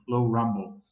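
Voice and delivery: plain reading voice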